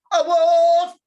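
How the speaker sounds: high, loud shriek; screaming in sudden fright; scream of alarm. Acted. Grandma spots the wolf at her door, high shriek. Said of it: "she just looked at me and said".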